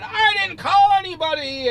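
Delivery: high, strained mock yell; angry dad yelling. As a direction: yell it like an irate father. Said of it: "the dad starts yelling".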